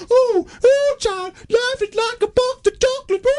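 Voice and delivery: very high, loud mocking wail; Forrest Gump. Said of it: "doing a Forrest Gump impression".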